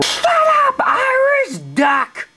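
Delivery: a very high, slow screaming yell; furious shout. Acted. Teacher screaming at Irish duck in class, high yell. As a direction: explode at the student.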